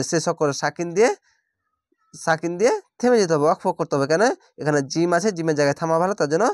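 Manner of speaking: ordinary talk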